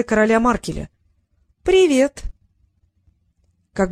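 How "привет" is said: high, louder chirpy hello; girl cheerfully greets the rival, high and louder; cheery greeting. A narrator's reading. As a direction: toss out a bright little hi.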